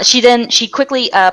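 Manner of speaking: ordinary delivery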